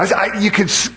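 usual speaking voice

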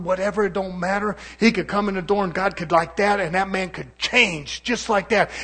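rising gospel fervour on one phrase; preaching at full force. Acted. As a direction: lift it into a full-voiced sermon cry. Preacher builds to a peak.

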